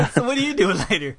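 fast, loud, laughing pickup line; laughing flirt. Pretend. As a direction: flirt cheesily through a laugh. Flirting with the phone agent.

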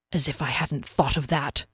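low, quiet cross muttering to himself; cross inner thought. A narrator's reading. Captain silently reacting to dwarf's advice, quietly cross. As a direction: think it crossly, kept under the breath. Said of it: "Derry thought crossly".

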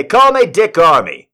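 lightly hammed-up senator, high and loud; mock-proud self-introduction, projected; imitating a senator. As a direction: announce yourself as the senator. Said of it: "He'd say".

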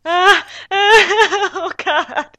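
high, loud mock-sobbing wail; tearful groan. Real, not acted. Listener reacting to the sad farewell, tearful wail. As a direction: wail it out between laugh and sob.

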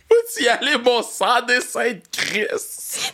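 laughing through an imitated curse; slow, laughing try at her swearing; teasing her car curses while laughing; laughing mock swear. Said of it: "le monde qui sac".

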